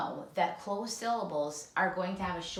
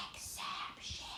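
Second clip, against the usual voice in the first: whispered "exception"; 11 dB quieter than usual. Shown as a demonstration. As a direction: breathe it out like a secret. Teacher whispers rule word.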